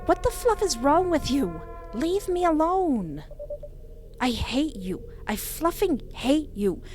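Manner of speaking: high, hurt and furious; angry outburst. Acted. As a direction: lash out in raw hurt. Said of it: "startled from my outburst".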